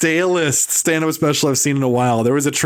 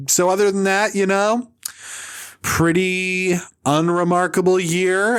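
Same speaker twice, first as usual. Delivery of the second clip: Marc Maron; 5 semitones higher than usual. Retold or imitated. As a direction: stretch out the sarcastic punchline. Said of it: "all he's gonna be saying".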